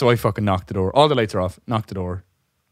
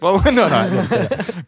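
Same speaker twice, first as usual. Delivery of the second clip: higher, laughing fake protest; laughing denial; 9 semitones higher, 6 dB louder than usual. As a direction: deny it while laughing. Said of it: "And I was like".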